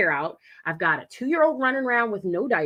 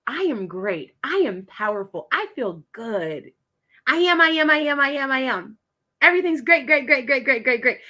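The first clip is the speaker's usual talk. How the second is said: building to loud, higher chant; powerful affirmation; 4 semitones higher, 4 dB louder than usual. Shown as a demonstration. Coach demonstrating power pose affirmations, building excitement. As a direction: pump yourself up, chin high. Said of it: "Chin up".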